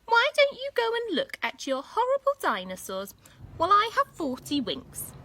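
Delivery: put-on high sing-song old granny; sleepy granny brushing Katy off, high and loud; grandma voice.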